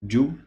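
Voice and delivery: "ju" said short and clear with a rounded u; syllable with dhamma. Shown as a demonstration. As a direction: model "ju" with a rounded u. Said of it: "With the dhamma".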